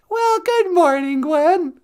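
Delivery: high sing-song good morning; excited greeting. Real, not acted. Host greets Gwen in the chat with a high sing-song welcome. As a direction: welcome her brightly.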